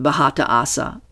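plain reading voice

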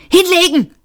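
high, loud command; shouted warning. A narrator's reading. Man ordering Seyfort to duck, shouting. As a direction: yell for instant cover. Urgent warning as robot flies over.

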